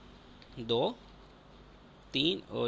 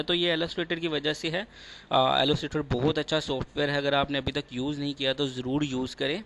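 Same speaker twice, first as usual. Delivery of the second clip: loud upbeat outro; 2 semitones higher, 8 dB louder than usual. Acted. Tutor signs off to subscribers in a louder, eager outro voice. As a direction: sell it like a closing YouTube pitch.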